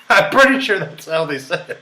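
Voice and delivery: cracking up at his own joke; laughing. Real, not acted.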